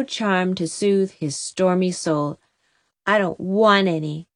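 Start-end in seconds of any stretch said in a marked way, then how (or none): none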